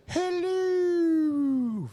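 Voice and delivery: very high, loud, drawn-out falsetto hoot; silly belly voice. Retold or imitated. Quoting a Seinfeld gag to friends, silly belly voice. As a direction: imitate a talking belly button, loud and squeaky. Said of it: "they were doing this little voice".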